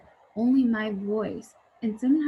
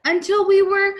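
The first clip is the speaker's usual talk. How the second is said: much higher and louder, trailing off; dad realizing, higher and louder; amazed; 11 semitones higher, 10 dB louder than usual. Acted. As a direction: reach for the answer, wondering. Dad puzzling it out.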